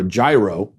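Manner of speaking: "gyro" as JAI-roh; mistaken pronunciation. Shown as a demonstration. Host orders at a food truck, saying JAI-roh. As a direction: say "gyro" with a J, like gyroscope. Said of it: "I've been saying gyro this whole time".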